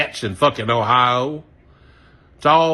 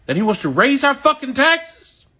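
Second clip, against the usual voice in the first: angry outburst; 11 semitones higher, 5 dB louder than usual. Real, not acted. Angry rant about taxes.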